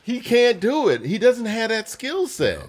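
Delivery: high-pitched, drawn-out protest; exasperated high-voiced rebuttal; insistent objection. Real, not acted. Heated argument over a player's ability.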